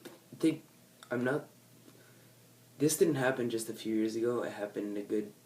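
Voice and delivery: ordinary talk, nothing marked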